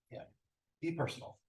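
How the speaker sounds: ordinary talk, nothing marked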